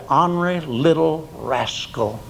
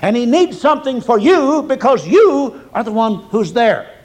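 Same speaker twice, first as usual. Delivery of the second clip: fervent preaching; 6 semitones higher than usual. Acted. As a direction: preach it out with rising heat. Preaching at full force.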